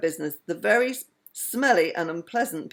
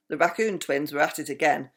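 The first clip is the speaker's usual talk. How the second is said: ordinary delivery, nothing marked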